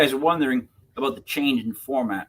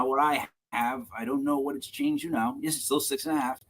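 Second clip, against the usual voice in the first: ordinary delivery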